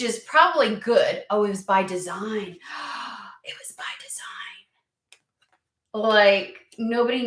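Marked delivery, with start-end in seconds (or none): 2.6-3.2 sharp intake of breath, mock shock; quick mock gasp. 3.4-4.7 whispered, playful conspiracy; secret whisper. Pretend. Conspiratorial whisper after gasp.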